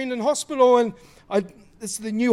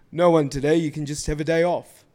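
mock God voice; 7 semitones lower, 3 dB louder than usual. Mock God giving excuse.

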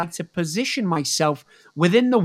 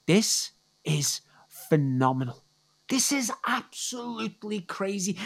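whispered in wonder; awed whisper; 2 semitones higher, 7 dB quieter than usual. Real, not acted. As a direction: let it slip out as a whisper. Awed aside in the sermon.

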